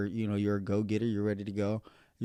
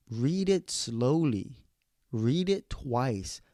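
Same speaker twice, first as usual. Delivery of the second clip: very slow, one word at a time; slow, firm advice; 2 semitones higher, much slower than usual. Retold or imitated. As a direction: drive the instruction home, drawing out "slowly" and "twice". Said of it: "And I told him, like".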